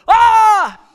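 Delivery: sudden loud, high "ah!" to scare someone; jump-scare shout; startling yell. Retold or imitated. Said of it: "I would stop, and I would go".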